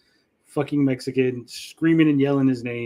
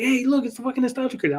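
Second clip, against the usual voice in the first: excited screaming; 11 semitones higher than usual. Retold or imitated. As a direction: squeal like a starstruck fan spotting a celebrity. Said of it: "I'm fucking going nuts".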